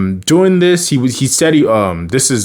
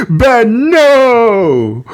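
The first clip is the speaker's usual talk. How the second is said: panicked cry; 12 semitones higher, 5 dB louder than usual. Retold or imitated. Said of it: "He's like".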